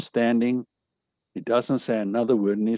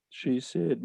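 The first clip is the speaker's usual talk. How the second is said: ordinary delivery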